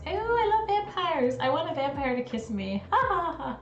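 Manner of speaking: high, swoony gushing, hammed-up; put-on high dreamy voice with a mock giggle; mock vampire fangirl. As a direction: imitate a lovestruck fan squealing over vampires. Mocks gushing vampire fans.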